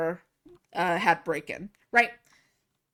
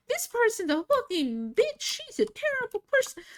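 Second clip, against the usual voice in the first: much higher, shrill mocking imitation of a cussing girl; mock angry girl; 14 semitones higher than usual. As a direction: squeal the insults like the tattling girl. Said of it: "She started going".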